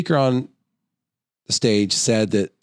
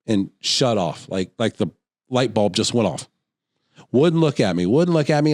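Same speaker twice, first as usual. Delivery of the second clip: ordinary talk, nothing marked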